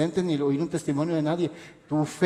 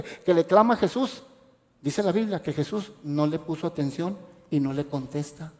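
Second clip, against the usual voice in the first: usual speaking voice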